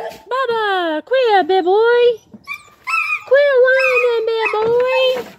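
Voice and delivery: very high, slow sing-song pet voice. Real, not acted. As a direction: coo at the whining dog, stretched out. Scolding neighbor's whining dog.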